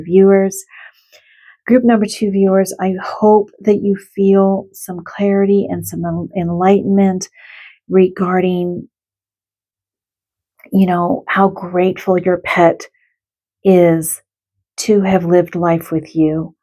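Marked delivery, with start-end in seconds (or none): none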